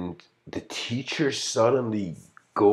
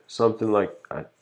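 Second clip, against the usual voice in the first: usual speaking voice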